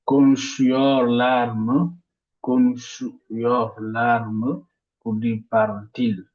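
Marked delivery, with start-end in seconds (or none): 0.1-4.7 Konuşuyorlar mı slowly, weight on the syllable before mı; stress before mı. Shown as a demonstration. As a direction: lift into mı with a slow rise. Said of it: "l'accent tonique sur". (accent tonique avant mı, lentement)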